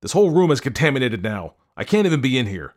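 high, agitated husband; panicked. A narrator's reading. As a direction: wail about contamination. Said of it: "as scared as a toddler".

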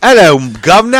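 loud, high cockney-style British greeting; hammed-up British "guvnor" call; mock British accent. Greeting a British online friend, mock British accent. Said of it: "go from British to Australian".

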